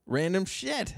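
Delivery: squeaky teasing repeat; high mocking echo.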